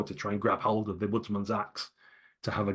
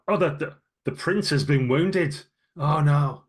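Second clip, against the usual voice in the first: higher, louder panicked bystander; alarmed crowd cry; 6 semitones higher, 7 dB louder than usual. Acted. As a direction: cry out that the prince is hurt.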